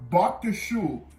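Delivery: ordinary talk, nothing marked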